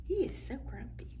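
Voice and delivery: fast hushed whisper. Real, not acted. Reader whispering to children about the monkey. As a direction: whisper it as a secret. Aside to listener.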